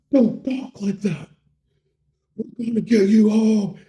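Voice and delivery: hammed-up desperate rallying; melodramatic urging. Bro rallies his dying friend, in mock desperation.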